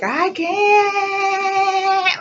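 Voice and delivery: high, held, strained cry; strained wail. Retold or imitated. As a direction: wail it like you can't go on. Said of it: "Because I was like".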